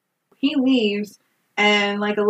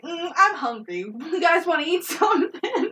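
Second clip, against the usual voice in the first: soft, higher mock-innocent dodge; playful evasion; 7 semitones higher, 3 dB quieter than usual. Clyde dodging Bonnie's advances, playfully evasive. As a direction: deflect lightly, a laugh creeping in.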